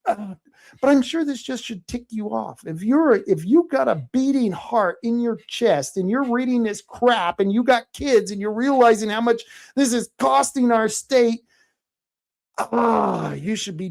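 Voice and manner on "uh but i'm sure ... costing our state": ordinary delivery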